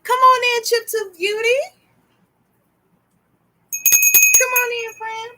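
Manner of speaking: very high sing-song invitation; cutesy high hello; high-pitched welcome. Real, not acted. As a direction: usher a friend in with a high coo. Welcoming viewers joining the live.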